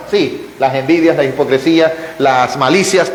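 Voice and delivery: ordinary talk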